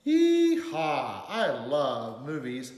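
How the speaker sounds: big storybook cowboy whoop; too-loud exclamation. A narrator's reading. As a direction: holler it like an overexcited kid. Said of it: "his words came out too loud".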